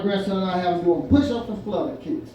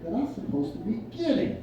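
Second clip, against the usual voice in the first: thinking aloud; 6 dB quieter than usual. Retold or imitated. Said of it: "standing there thinking".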